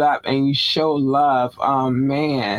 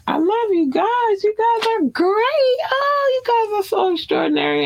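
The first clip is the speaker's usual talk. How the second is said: gushing falsetto. Acted. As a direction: coo at the fans in a high sugary falsetto. Gushing love to Nigerian fans.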